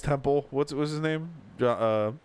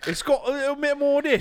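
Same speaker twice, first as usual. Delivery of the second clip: higher-pitched mock Cockney; Cockney accent; 9 semitones higher than usual. Showing friend one British accent, mock Cockney.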